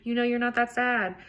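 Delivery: high, whiny stream of mock panic; frantic inner voice. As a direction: act out a nagging, self-scolding inner voice. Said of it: "I don't mean the you that's like".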